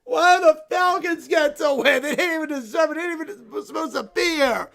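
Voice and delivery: stomp through the complaints like a sore loser; whiny tantrum. Acted. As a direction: bear fan sulking furiously at the loss. Bear wails about his team.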